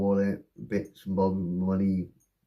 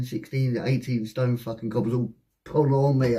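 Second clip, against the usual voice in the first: ordinary delivery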